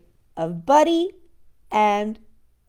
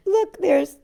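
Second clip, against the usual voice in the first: ordinary delivery, nothing marked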